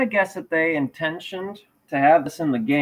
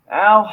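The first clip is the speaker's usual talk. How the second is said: pained ow; 5 semitones higher, 7 dB louder than usual. Real, not acted. Pricks himself on the staples.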